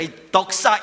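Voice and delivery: half-laughing pretend scolding; mock insult. Shown as a demonstration. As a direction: tease them with a mock name-call. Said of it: "이렇게 했으면은".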